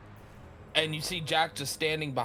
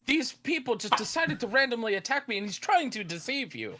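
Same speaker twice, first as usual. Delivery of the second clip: pleading villain; 10 semitones higher than usual. Acted. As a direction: beg innocence with false sincerity. GM voices Jack.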